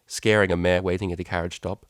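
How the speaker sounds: ordinary delivery, nothing marked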